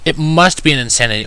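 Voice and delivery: ordinary delivery, nothing marked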